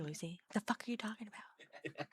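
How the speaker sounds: hushed, breathy what the fuck; whispered disbelief. Real, not acted. Unvoiced exasperated whisper.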